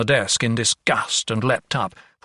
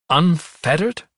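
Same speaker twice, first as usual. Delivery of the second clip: startled, higher-pitched questioning echo; indignant outburst; 5 semitones higher, 3 dB louder, slower than usual. A narrator's reading. Young doctor queries patient's treatment, indignant and startled. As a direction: throw the word back in indignation. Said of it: "the doctor's sudden outburst".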